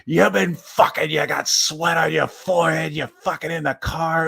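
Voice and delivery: high, loud mock yell as grandpa. Grandpa accusing Denise of sex in the car, high mock yell. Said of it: "It's like".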